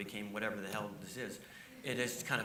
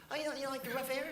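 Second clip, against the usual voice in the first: Leno impression; 12 semitones higher, faster than usual. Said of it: "he says".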